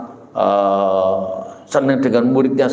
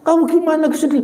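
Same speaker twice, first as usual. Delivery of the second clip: higher, quick concerned asking; worried sibling's question; 13 semitones higher, faster than usual. Retold or imitated. Kakak-kakak menanyai adiknya, nada cemas lebih tinggi. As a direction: show the siblings' alarm.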